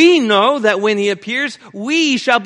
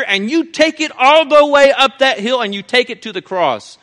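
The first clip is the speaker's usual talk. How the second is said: full-force preaching; 3 semitones higher, 5 dB louder than usual. Acted. Preacher pressing the church, loud full-force preaching. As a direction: preach it hard to the congregation.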